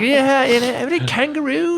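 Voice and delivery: put-on butcher an Australian accent for laughs; teasing a New Zealand coworker, hammed-up Aussie accent; mock accent. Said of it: "it was some sort of".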